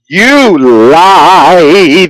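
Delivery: a hint of a wailing child, laughing through it; imitating a sobbing kid, lightly; mock crying child. Dad mimics his son caught finding out about Santa, mock crying. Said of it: "the way he cried".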